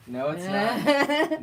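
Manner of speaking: loud, high, drawn-out mock protest; joking outburst. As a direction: stretch it out, climbing high and loud. Joking contradiction of own claim.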